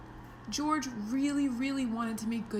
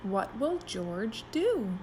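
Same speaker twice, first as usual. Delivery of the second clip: ordinary delivery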